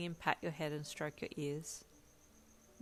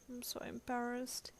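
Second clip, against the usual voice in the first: soft, slow embarrassed mumble; higher, softer, shy mumble; mumbled; 6 semitones higher, 5 dB quieter than usual. A narrator's reading. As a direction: mumble it into your hands. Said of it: "he mumbled".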